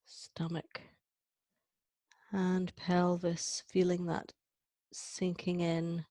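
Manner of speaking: slow, measured phrases with long pauses; calm meditation guide, drawn-out words; guided breathing meditation. Acted. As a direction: lead the breath through the body, softening on the way down. Leads a breathing exercise.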